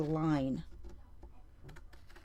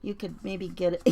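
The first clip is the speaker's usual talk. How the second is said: usual speaking voice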